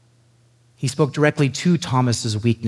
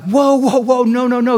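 much higher, louder whoa; alarmed refusal; 12 semitones higher, 5 dB louder than usual. Retold or imitated. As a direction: stop them in panic. Paul refuses worship.

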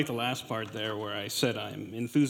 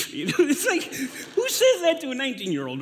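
laughing at himself, pitched way up; 12 semitones higher, 5 dB louder than usual. Real, not acted. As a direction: crack up mid-sentence at yourself. Laughing at own sentimentality.

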